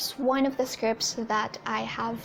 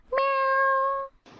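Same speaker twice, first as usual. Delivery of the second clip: cat meow; 12 semitones higher than usual. Acted. Cat meow at ad's end.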